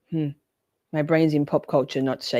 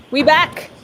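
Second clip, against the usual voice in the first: much higher, loud happy yell; 10 semitones higher, 8 dB louder than usual. Real, not acted. Streamer yelling to viewers that the trial is back, loud yell. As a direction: shout it out as the stream resumes. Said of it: "Sorry, I just yelled at you".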